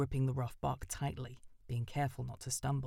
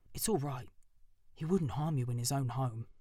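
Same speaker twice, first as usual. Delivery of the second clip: plain reading voice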